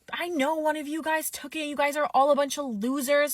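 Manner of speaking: high-pitched mock outburst; imitated freak-out. Drunk guest accusing the staff of theft, imitated freak-out. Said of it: "starts freaking out even more".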